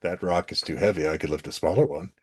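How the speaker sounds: low, soft comic Hercules dub; deadpan sketch impression, dropped low and quiet; underplayed mock-dub hero; Bill Murray. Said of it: "the Bill Murray Hercules sketch".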